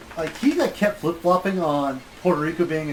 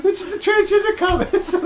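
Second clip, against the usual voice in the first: laughing; 7 dB louder than usual. Real, not acted. Laughing at own joke.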